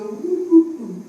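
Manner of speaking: high mock baby cooing. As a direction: coo like a happy baby. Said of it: "the baby would go".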